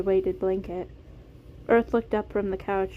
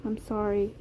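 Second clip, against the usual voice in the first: usual speaking voice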